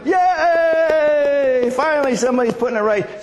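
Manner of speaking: long, high mock cheer; crowd cheering. Frustrated hockey queue cheering the bold friend, high excited cheer. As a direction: cheer high as the relieved crowd, then speak up for them. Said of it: "what happened was the crowds went".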